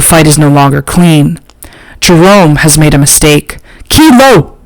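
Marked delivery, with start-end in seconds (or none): 3.9-4.6 shout. A narrator's reading. Rico calling his dog off the fight, high shout. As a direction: call your dog off sharply. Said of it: "Rico shouts".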